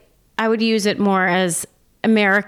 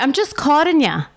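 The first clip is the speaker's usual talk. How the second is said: an Irish "I'm just caught in ya", pitched up; Irish accent; 7 semitones higher than usual. Shown as a demonstration. Friend teaching an Irish phrase, lightly Irish accented. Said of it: "What does that mean?".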